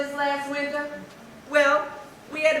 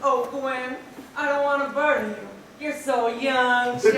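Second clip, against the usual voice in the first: ordinary delivery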